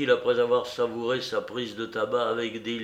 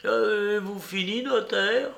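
put-on thin, high old man's voice; faint failing voice; 10 semitones higher than usual. A narrator's reading. Dying beggar hurrying the notary, faint high voice. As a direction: ask weakly, as if life is ebbing. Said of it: "reprit Caloche d'une voix éteinte".

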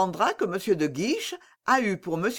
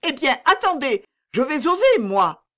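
defiant outburst; 6 semitones higher, 6 dB louder than usual. A narrator's reading. Exasperated princess takes over.

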